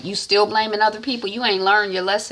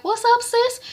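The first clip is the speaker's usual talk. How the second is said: high bright greeting, much higher; voicing little sister; 3 dB louder than usual. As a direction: play the younger sister, casual and up high. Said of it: "Shaliwa's like".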